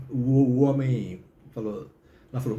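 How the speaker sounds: normal talking voice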